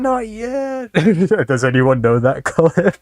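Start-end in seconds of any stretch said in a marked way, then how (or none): 0.0-0.9 lazy stoner drawl, lightly voiced; a hint of a lazy stoner. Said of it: "with a stoner voice saying".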